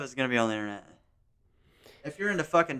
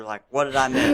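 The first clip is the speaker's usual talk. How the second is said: 1 laughing mock panic; 9 semitones higher than usual. Acted panicked quote.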